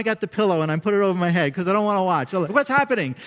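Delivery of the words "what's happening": higher, louder anxious question; mock alarm. Scared dad under a pillow during a movie, mock-alarmed. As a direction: play up the scared viewer.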